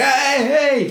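high-pitched calling out; attention-getting call. Retold or imitated. Runner hailing a man chopping wood, calling out. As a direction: call out to catch someone's attention. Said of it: "trying to get his attention".

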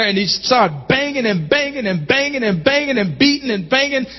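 usual speaking voice

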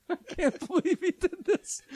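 high, slow, laughing out the words; can barely get it out for laughing; laughing through it. Real, not acted. Retelling the cake mix-up to the hosts, laughing hard.

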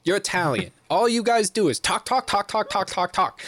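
hammed-up scolding teacher; mock tirade. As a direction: rant in imitation, climbing into a repeated jabber. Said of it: "this racist tirade".

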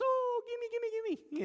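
very high, squealy greedy plea; greedy begging. Acted. Preacher mimics people wanting gifts, greedy and hammed-up. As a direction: play an overeager gift grabber.

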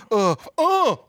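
high, caught-out stammering; caught-out stammer, high and halting; flustered stammer. Retold or imitated. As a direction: stammer like you were caught. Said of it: "He's like".

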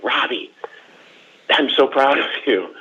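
high-pitched mom voice, full of pride; imitated proud mom. Mom calling her son about his letter, imitated proud gushing. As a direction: gush like a proud mother.

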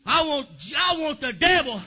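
normal talking voice

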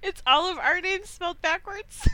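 high voice breaking into laughter; giggling confession. Real, not acted. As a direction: reveal the secret, about to burst. Reveals secret, starting to laugh.

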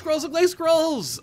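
excited. Real, not acted. Echoing cohost with delight.

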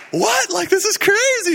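very high, incredulous exclamation; amazed disbelief. Retold or imitated. Guys watching a healed arm move, amazed disbelief. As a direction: squeal it as stunned onlookers, imitating their shock. Retold amazed onlookers react to healing.

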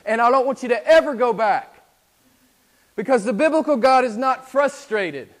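loud, high, driving sermon delivery; full-force preaching. Acted. Preacher warning the congregation about a weak God, loud and high. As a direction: thunder it from the pulpit as a warning.

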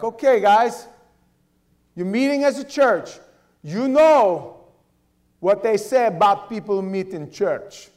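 loud, high mock Russian thug; Russian accent. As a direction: bark it in a heavy Russian accent. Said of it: "That's my Russian accent".

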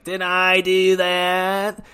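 drawn-out faux-innocent question; slow mock innocence. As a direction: stretch it out like a cartoon nerd. Kenny quote drawn out.

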